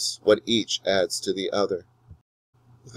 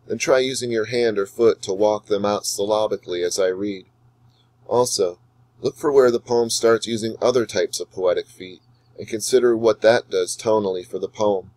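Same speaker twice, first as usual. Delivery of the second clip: ordinary talk, nothing marked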